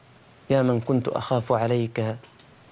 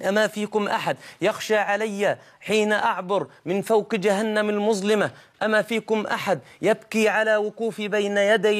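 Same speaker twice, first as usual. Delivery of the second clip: higher, mounting lament at full force; impassioned preaching; 8 semitones higher than usual. Acted. Dying man pleads with his weeping family, impassioned preaching. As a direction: let each plea climb, pleading.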